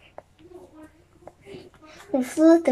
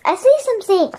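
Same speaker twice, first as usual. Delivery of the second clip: high, louder exclamation; raised, urgent storybook voice; excited cry; 9 semitones higher, 5 dB louder than usual. A narrator's reading. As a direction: cry out at a sudden sighting. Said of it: "cried Annie".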